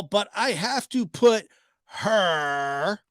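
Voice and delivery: slow, drawn-out "put her"; imitating her delivery. Vlogger mimics her slow drawn-out wording, mild. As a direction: stretch the words the way she said them. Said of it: "that's how she says it".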